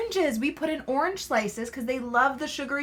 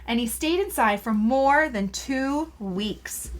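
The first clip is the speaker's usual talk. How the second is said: lively storybook read, words drawn out; reading to children; 3 semitones higher than usual. Acted. Mother reading a caterpillar book to her child, lively storybook voice. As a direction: read it aloud to a small child.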